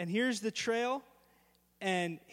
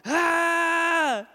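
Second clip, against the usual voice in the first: high, loud scream; retold scream; 7 semitones higher, 9 dB louder than usual. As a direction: keep on screaming. Scream continues.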